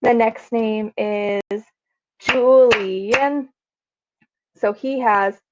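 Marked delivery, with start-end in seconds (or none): 2.2-3.5 Julian stretched into three beats, Ju-li-an; syllable by syllable. Shown as a demonstration. Teacher counting beats in a name, Julian syllable by syllable. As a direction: clap out Julian, each syllable drawn out. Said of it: "three beats in his name".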